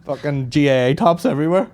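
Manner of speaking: usual speaking voice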